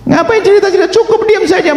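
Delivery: very high-pitched rhetorical rebuke; exasperated scolding. Real, not acted. Preacher rebuking those who tell past sins, high and exasperated. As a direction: scold them, voice leaping right up.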